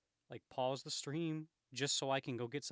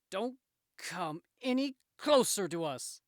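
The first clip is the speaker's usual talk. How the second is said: slow, loud, higher warning; wary bystander; 8 semitones higher, 12 dB louder, slower than usual. Acted. Bystander warning Spider-Man away, slow and alarmed. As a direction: ward him off, word by word. Frightened bystander warns off the hero.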